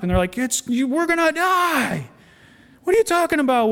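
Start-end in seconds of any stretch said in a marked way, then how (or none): 0.4-2.1 high, drawn-out mock wail; hammed-up panic, pitch soaring; panicked disciples. Disciples freaking out in the storm. 2.8-3.7 high-pitched acted exasperation; incredulous reply. Jesus answers the panicking disciples.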